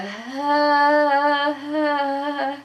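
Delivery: strained high laugh-groan held back; pained laugh. Retold or imitated. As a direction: laugh that hurts, half groan. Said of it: "the only sound that I could get out of my body was".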